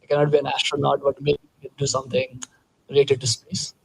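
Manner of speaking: ordinary delivery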